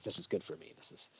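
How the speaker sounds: softly voiced approving thought; acted, hushed self-reassurance; quiet self-talk. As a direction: murmur it to yourself, approvingly. Voices approving user's inner thought.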